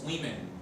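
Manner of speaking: women with the O said as I, wimen; la O de women suena como I; English vowel demo. Shown as a demonstration. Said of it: "Esa vocal O suena como I".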